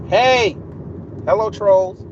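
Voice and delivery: high, loud hey to the trolls; mocking shout hey; loud greeting. Real, not acted. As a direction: call out to them loudly. Calling out to trolls.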